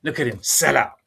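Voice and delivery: lightly voiced grumbling detractor; mock exasperated complaint, slightly put on; mock disgruntled critics. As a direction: imitate someone groaning at a betrayal. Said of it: "crackers are probably saying".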